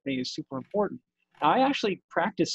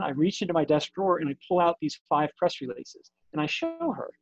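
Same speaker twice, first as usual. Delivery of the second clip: usual speaking voice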